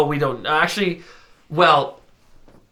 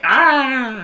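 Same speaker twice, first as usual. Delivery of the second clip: high puppet bear ah; Fozzie Bear's ah; 11 semitones higher, 4 dB louder than usual. Retold or imitated. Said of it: "he wiggles his ears like".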